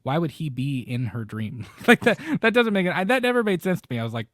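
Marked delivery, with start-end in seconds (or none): 1.9-4.0 fast, high, laughing rant; giggly fast complaint; laughing disbelief. Real, not acted. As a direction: laugh it off in disbelief, quick and loud.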